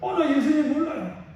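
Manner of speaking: lying Peter. Acted. Peter lying to the crowd to save himself, evasive. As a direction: brush it off as if you never knew him. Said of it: "거짓말하는 베드로".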